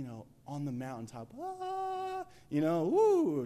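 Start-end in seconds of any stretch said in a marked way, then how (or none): 1.4-2.2 long, very high heavenly "ah"; mock mountaintop rapture; elated high cry. Imitating mountaintop spiritual elation. 2.8-3.5 loud, high "woo"; celebratory whoop. Pretend. Celebrating on the spiritual mountaintop.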